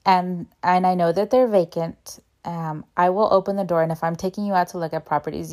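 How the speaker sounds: normal talking voice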